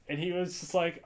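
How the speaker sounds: ordinary talk, nothing marked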